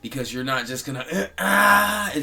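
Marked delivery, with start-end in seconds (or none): 1.1-2.1 short, loud, high "ah" outburst; mock angry scream. Speaker showing what losing your temper is like, mock scream. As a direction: let out a mock blow-up cry. Mimics blowing up in anger.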